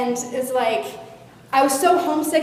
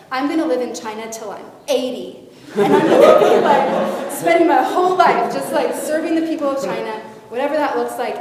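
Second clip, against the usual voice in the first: laughing, higher and louder; laughing eager vow; 3 semitones higher, 8 dB louder than usual. Retold or imitated. A girl vowing to serve China, laughing and excited. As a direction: gush it out through laughter.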